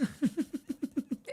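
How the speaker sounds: quiet suppressed giggle; soft, tickled snicker; laughing. Real, not acted. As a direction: chuckle softly to yourself. Laughing while typing a note.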